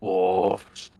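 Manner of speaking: "Dwarves." whispered right into his face; menacing whisper. Acted. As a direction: lean in close and whisper "Dwarves." as a threat. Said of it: "puts his face very close to this guy".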